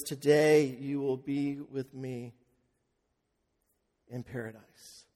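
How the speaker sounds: hushed and gentle, like a blessing. Retold or imitated. Jesus' words heard by believers.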